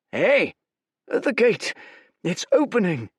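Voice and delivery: loud, high alarmed cry; alarmed shout. Acted. Soldier shouts to the army the gate opens, alarmed. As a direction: warn everyone at once.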